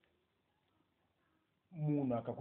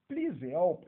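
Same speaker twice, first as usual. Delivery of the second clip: louder, higher plea for help; urgent plea; 6 semitones higher, 8 dB louder than usual. Shown as a demonstration. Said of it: "really need urgent help".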